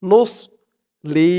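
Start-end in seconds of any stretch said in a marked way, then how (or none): 0.0-0.3 নুস with a full, heavy mouth on the ص; heavy sad sound. Shown as a demonstration. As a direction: fill the mouth when saying নুস. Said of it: "মুখটা একটু ভরপুর হয়ে যাবে".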